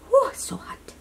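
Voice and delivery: normal talking voice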